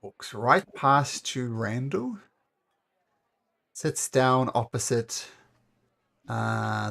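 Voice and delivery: normal talking voice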